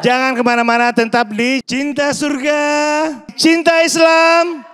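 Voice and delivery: show tagline announcer. Real, not acted. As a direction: announce the break, stretching the programme name. Host's tagline before the break.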